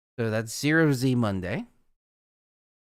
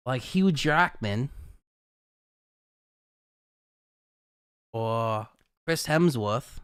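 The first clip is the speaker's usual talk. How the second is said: slow, lightly attempted Aussie accent; attempted Australian accent; slower than usual. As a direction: have an unsure go at an Australian accent. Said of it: "no idea if that was a good Australian accent".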